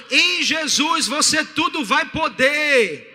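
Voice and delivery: loud, drawn-out rallying shout; big triumphant pulpit cry; full-force preaching. Real, not acted. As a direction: proclaim it to the whole congregation.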